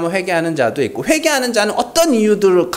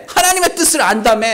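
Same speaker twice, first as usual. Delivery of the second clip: high-pitched taunt; needling, sarcastic challenge; mocking sarcasm; 6 semitones higher than usual. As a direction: jeer at their claim in a raised pitch. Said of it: "비아냥거리는 거예요".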